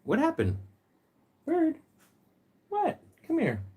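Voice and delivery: sudden high-pitched coo; squeaky worried pet voice; high pet talk. Real, not acted. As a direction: check on the bird in a high voice. Sudden high coo to startled bird.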